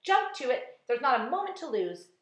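loud, high urgent command; shouting. A narrator's reading. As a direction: order the crew to hurry. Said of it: "James shouted".

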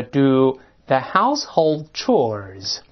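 teacher modelling a set phrase slowly; slow teaching read. Shown as a demonstration. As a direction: do the household chores said slowly, word by word. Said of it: "当然这还有另外一个表达".

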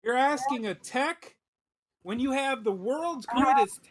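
high, huffy mock outrage; mock indignant. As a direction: play it hurt and haughty. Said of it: "I just wanted to be indignant".

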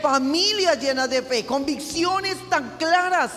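full-force sermon delivery; fervent preaching. Real, not acted. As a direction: preach it home to the congregation, building.

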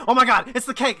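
loud, high squeal of excitement; imitating a friend losing it over cake; loud excited shriek. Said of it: "she lost her mind".

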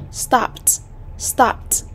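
"Stopped" ending in a released T; "Stopped" modelled as one syllable, stop-t; final T sound. Shown as a demonstration. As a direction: pronounce the -ed of "Stopped" as a T. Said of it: "Đây là âm T".

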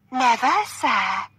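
high, loud mock-dramatic reply; playful. A narrator's reading. Catherine answering her partner's game, playfully theatrical. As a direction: play along with the theatrical game. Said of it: "enjoying the game".

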